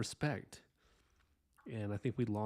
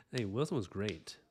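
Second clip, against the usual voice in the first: normal talking voice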